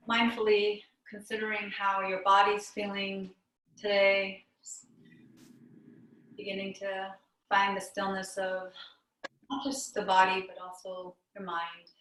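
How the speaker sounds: calm meditation teacher with long pauses; guided meditation. Real, not acted. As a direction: guide them inward, unhurried, leaving long silences between phrases.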